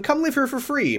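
high welcoming mock voice; mock hostess. Mocking Kathleen inviting everyone but Grace, high sweet voice. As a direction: gush the invitation. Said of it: "Kathleen who's like".

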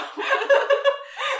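high loud burst of laughter. Real, not acted. Laughing fit after slip.

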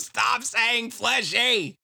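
high, loud, drawn-out complaint; exasperated yell. Acted. Podcaster fed up with a repeated word, exasperated yell. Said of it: "I just wrote".

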